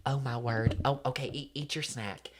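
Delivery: soft, squeamish, halting voice; Natalie. Retold or imitated. Imitating castaway killing a rat, soft and hesitant. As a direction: coax timidly, imitating a reluctant rat killer. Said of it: "like Natalie when she kills the rat".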